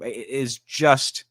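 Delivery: ordinary talk, nothing marked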